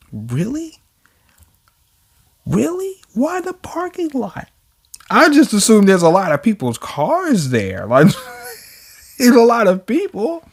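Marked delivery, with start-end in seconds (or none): 0.1-4.5 high, soft disbelieving questions; incredulous. Real, not acted. Reader balks at the parking lot choice, incredulous. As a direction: squeak out your disbelief quietly.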